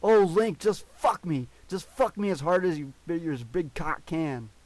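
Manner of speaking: high, loud passionate cry, easing off at the end; scream of passion. A narrator's reading. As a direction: cry out in abandon. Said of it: "Navi screamed".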